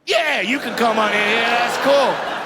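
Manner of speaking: loud, drawn-out okay; welcoming security guard. Retold or imitated. Security guard letting the comedian in, loud welcoming call. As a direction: call it out loudly, stretching the end.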